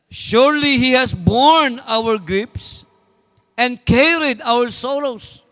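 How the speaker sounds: slow, solemn Bible reading; scripture reading. Acted. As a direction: read each phrase slowly, weighing it. Reads scripture over music. (mabagal at taimtim na pagbasa ng Bibliya)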